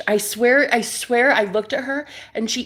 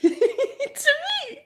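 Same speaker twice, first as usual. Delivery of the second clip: very high, squeaky words through laughter; laughing; 3 dB quieter than usual. Real, not acted. Shocked she said it to me, laughing high. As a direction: laugh while saying it.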